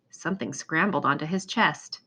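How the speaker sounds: usual speaking voice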